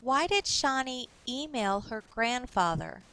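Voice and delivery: ordinary talk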